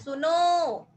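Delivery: drawn-out calling "सुनो", vowel held long; prolonged call. Shown as a demonstration. Teacher demos a pluta vowel, calling out long. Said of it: "किसी को पुकारने के लिए".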